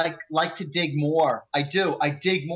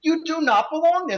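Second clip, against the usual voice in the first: high, emphatic rebuke; indignant telling-off, re-enacted; retold annoyance; 11 semitones higher than usual. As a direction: tick them off with a raised pitch. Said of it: "he was ticking me off".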